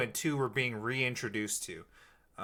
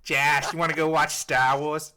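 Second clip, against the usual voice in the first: loud mock Boston, dropped r's; New Yorker impression slipping into Boston; Boston-like accent; 2 semitones higher, 5 dB louder than usual. Shown as a demonstration. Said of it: "No, that's more Boston".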